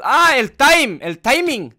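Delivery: high, loud burst of realization; sudden recall. Real, not acted. Gamer finally finds the word for friend, excited outburst. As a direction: call it out as the word finally comes.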